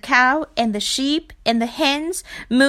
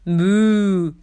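a lightly voiced cow; cow moo; 4 dB louder than usual. A narrator's reading. Cow speaks.

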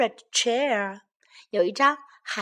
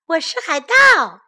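high, very loud boast; loud shout; 6 semitones higher, 9 dB louder than usual. A narrator's reading. Baby bear playing pirate, high loud shout. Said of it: "熊宝宝大声喊道".

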